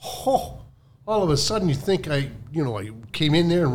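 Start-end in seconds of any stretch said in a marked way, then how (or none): none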